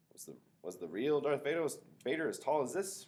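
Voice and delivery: put-on high, loud excited kid question; eager little kid asking. A narrator's reading. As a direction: ask it thrilled. Freak asks riding on shoulders.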